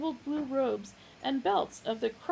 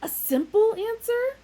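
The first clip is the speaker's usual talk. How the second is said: much higher, loud; eager; 14 semitones higher, 11 dB louder than usual. A narrator's reading. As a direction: squeak it out eagerly.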